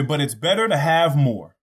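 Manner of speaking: ordinary delivery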